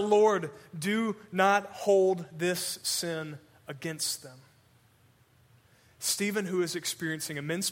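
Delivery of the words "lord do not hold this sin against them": drawn-out slow plea; dying man crying out for his killers, slow; earnest cry. Acted. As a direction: stretch every word, pleading for them. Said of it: "He cries out".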